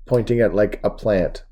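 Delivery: ordinary delivery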